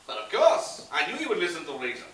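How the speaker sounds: a con man playing a Frenchman, French accent throughout; fake French accent. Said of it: "That doesn't sound very French, Pierre".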